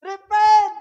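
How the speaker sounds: loud preaching cry of Repent; shouted Repent. Acted. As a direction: call out Repent like a prophet. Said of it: "he said".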